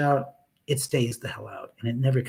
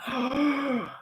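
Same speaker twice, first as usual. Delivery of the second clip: gasp; 11 semitones higher, 3 dB quieter than usual. Real, not acted. Shocked at killers' release.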